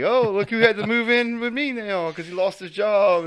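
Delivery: high, smug mocking jab; smug sneer. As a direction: gloat over him, sneering. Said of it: "He's like".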